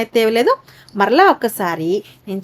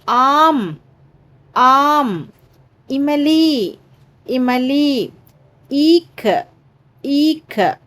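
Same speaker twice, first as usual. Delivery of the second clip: classroom recitation; 3 semitones higher, much slower than usual. Acted. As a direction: read each word twice for pupils to echo. Teacher reads word list twice.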